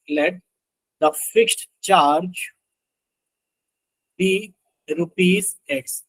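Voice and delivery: very slow, word-by-word dictation. Real, not acted. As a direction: dictate it in pieces with long pauses. Dictates while writing out the equation. (धीरे-धीरे रुक-रुक कर लिखवाते हुए)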